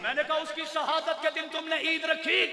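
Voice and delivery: normal talking voice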